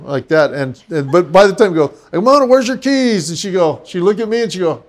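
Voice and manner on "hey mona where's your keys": high-pitched, projected question; wake-up call. Retold or imitated. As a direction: holler to rouse her, playfully. Repeats his wake-up call.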